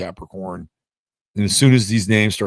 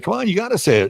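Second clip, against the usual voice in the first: much higher, egging-on voice; pitched-up planet urging you on; urging Jupiter; 11 semitones higher, faster than usual. Acted. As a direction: push them to say it, pitched up high. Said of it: "It's like".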